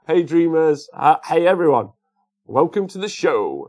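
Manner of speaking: loud, high, hailing the crowd; big show welcome. Real, not acted. Streamer welcoming arriving dreamers to the show, loud greeting. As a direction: call out like a host opening the show. Host calls welcome to viewers.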